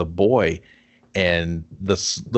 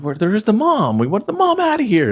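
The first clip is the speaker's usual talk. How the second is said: high, loud mock complaint; mock exasperation; 14 semitones higher, 4 dB louder than usual. Viewer complains to the movie, high and loud. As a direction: whine it at the movie.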